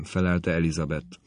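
ordinary delivery

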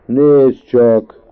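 loud, high indignant yell; shouting. A narrator's reading. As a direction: shout it back in outrage. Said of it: "kiabált Tök Marci".

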